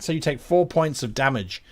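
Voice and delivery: normal talking voice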